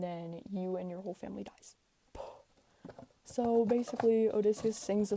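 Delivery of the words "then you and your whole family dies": whispered doom, breathy and hushed; spooky whisper. Acted. Whispering the grim ending to the listeners. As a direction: breathe the doom like a campfire tale.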